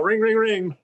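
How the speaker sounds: sing-song high ding of a church bell; imitated bell ringing. Imitating the priest's bell in the film, high chiming. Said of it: "that priest with his bell".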